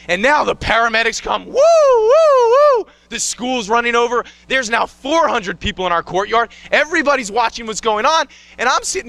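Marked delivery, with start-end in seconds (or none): 1.5-2.9 high, loud wailing woo of a siren; siren imitation. Paramedics arriving, mimicking their siren, high and loud. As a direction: sing out the ambulance siren.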